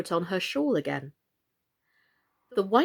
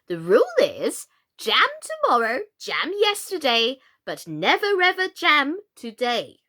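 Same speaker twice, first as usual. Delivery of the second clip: higher, louder queenly pronouncement; 6 semitones higher, 7 dB louder than usual. A narrator's reading. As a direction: lay down the rule like a dotty queen.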